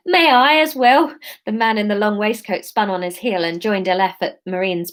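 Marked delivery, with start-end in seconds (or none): 0.1-1.2 slow, louder Cockney gent; Cockney accent. Acted. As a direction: lay on a Cockney twang. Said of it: "let's go Cockney".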